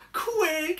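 high, loud yelp; urgent cry. Acted. Harold fleeing the hatchlings, panicked shout. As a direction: cry out to hurry. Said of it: "he cried".

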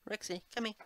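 high coaxing call to a pet. Real, not acted. Player calls Rexy to come over, high pet-calling voice.

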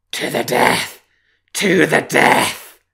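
howled, high, loud and slow; wolf howl. Acted. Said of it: "howled the wolf".

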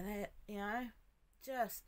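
ordinary talk

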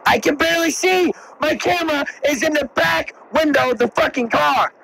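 high, whiny complaint; exasperated. Real, not acted. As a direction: complain in frustration. Frustrated about camera.

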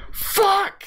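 high, loud curse; frustrated yell. Real, not acted. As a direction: snap out in frustration. Frustrated at a bad rhyme.

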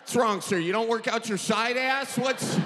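ordinary delivery

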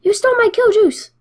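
high, loud mock outrage; mock angry shout. Gamer imitating angry rival player, high mock shout. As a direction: squeal it like a sore loser. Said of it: "That guy's, like, so mad".

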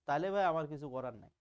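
ordinary talk, nothing marked

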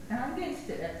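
ordinary delivery

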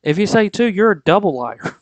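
rising preacher's challenge to the listeners; fired-up preaching. Real, not acted. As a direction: preach it out to the congregation. Preaching at full force.

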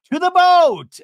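high, very loud call; mock rallying shout. [name] sending players to the boat, mock shout. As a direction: holler it like a hammy host. Host calling players, acted out.

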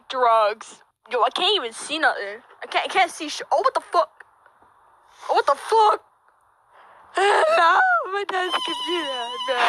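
ordinary talk, nothing marked

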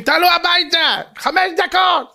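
put-on high, stretched call to children; mock parental holler; imitated parents yelling. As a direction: call the kids in from the window. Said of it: "ההורים בשמונה צועקים".

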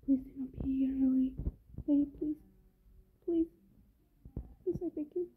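frightened whisper; hushed plea while hiding. Real, not acted. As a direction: breathe the words so she won't hear. Hiding from the monster.

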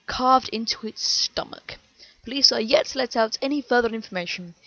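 loud, punchy newsreader delivery; news anchor. A narrator's reading. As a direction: read it like a breaking news anchor.

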